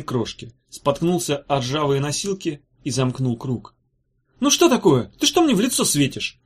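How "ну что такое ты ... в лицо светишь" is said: high, louder impatient snap; irritated, hurry-up questioning. Acted. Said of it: "нетерпеливо спросил Корнелий".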